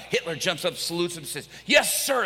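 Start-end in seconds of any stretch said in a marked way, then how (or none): 1.7-2.2 loud, high, crisp acknowledgement; military salute. Retold or imitated. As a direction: shout it like a recruit saluting. Prisoner jumps up and salutes.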